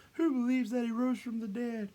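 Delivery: high, mock crowd-rousing call; rallying call. Leader asking believers about Christ, high acted rallying call. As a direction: play the showman polling a crowd.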